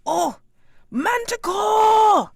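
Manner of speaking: very high, loud, drawn-out cry. Acted. Tuttle crying out a warning to the crowd, high loud wail. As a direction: wail the alarm. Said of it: "the voice of Tuttle crying out".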